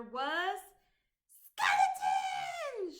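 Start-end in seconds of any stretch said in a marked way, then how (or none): 1.5-2.9 high, loud, slow shout. Acted. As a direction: call out joyfully across the swamp. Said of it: "shouted Goblin".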